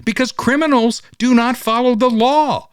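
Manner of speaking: high, forceful point-making; emphatic. Real, not acted. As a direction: hammer the point home high. Driving home a point.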